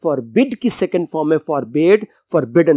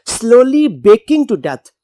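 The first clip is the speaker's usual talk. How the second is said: slow text reading; 4 semitones higher, 4 dB louder, much slower than usual. Shown as a demonstration. Teacher reading the lovely phrase to students, slow and emphatic. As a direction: savour slowly baking to death, drawn out and louder.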